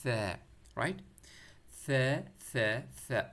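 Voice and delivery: repeated careful demo of ثا as "thaa"; tongue-between-teeth tha. Shown as a demonstration. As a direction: say ثا with tongue tip touching upper teeth. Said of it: "زبان باہر نکال کے اوپر والے ٹیٹ کے ساتھ touch کرنا".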